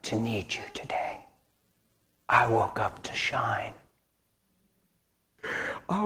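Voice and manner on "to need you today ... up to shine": slow hushed conviction; slow whisper. Real, not acted. Hushed slow sermon line.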